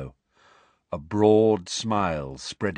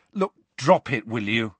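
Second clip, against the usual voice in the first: burst out; 3 semitones higher than usual. A narrator's reading. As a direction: cut him off sharply. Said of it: "Harry burst out".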